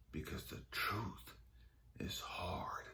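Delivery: very soft and low, with long pauses; almost whisper it, phrase by phrase; slow, grave refrain; hushed pronouncement. Retold or imitated. Repeats God's saying as hushed refrain.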